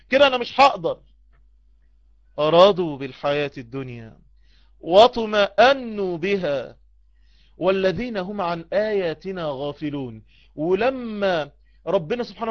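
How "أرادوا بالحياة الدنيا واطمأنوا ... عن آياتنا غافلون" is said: Quran recitation. Acted. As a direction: recite it low and unhurried.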